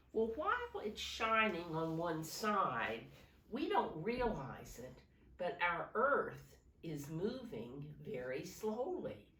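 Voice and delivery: normal talking voice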